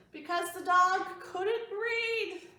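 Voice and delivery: put-on reading the joke's answer to the kids; punchline. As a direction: land the punchline slowly. Joke punchline read.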